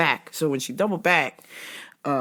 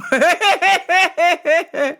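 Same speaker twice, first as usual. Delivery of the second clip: loud, high laugh; high cackle; 12 semitones higher, 5 dB louder than usual. Real, not acted. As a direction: crack up at yourself. Laughing at own story.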